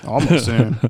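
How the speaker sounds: ordinary talk, nothing marked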